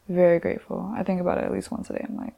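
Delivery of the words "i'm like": ordinary talk, nothing marked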